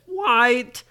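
put-on high, loud, let-down child; dismayed protest. Brady gets the wrong colour.

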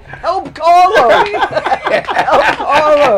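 high, loud, slow laughing call; hammed-up cry for help through laughter; calling the back room for help, loud and laughing; urgent call for help. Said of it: "I gotta run to the back room and say".

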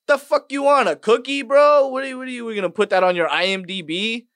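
loud, high-pitched incredulous rant; indignant mocking. Comic confronting a friend over photo credits, indignant mocking. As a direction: taunt him in exaggerated outrage.

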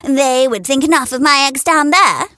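high, lofty hen boast. A narrator's reading. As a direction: brag about her eggs. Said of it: "she would boast".

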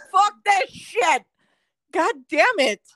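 exasperated cursing. Acted. Worker opens the jammed printer, cursing in high exasperation.

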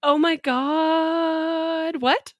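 drawn-out, high "oh my God"; shocked. Real, not acted. Reacting to the pay gap stat.

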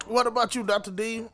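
ordinary talk

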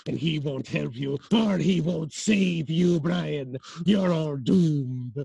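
slow ominous raspy drawl. Acted. Villain foretells doom.

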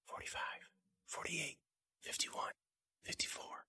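hushed whispered counting; whispering. Acted. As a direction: breathe the numbers without voice. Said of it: "They were whispering to themselves".

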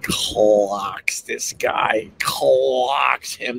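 "clocks" stretched out slowly; drawn-out emphasis. Real, not acted. Brother punching the guy on the train, slow-motion emphasis. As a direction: draw out "clocks" for impact.

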